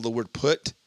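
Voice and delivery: ordinary delivery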